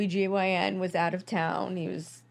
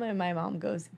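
ordinary talk, nothing marked

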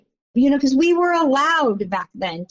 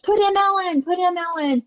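retold yelling at coach; 8 semitones higher than usual. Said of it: "we'd be screaming at the coach".

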